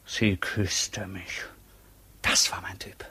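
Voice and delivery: slow, breathy secret whisper. Acted. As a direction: confide it in a hush. Intimate memory of the kiss. (geflüstert, langsam und vertraulich)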